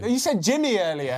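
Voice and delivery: high loud teasing call; teasing shout. Pretend. Teasing co-host loudly.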